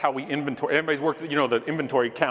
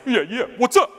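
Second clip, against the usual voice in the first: mock taunting; 7 semitones higher than usual. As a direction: jeer like a kid hiding behind his mom. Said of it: "I'm behind Brenda like this".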